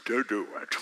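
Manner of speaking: very low, slow, eerie devil voice; devil's voice. Acted. Preacher playing the devil's voice tempting Mark, very low and slow. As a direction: drag it out deep like a demon. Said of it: "That sounds like the devil".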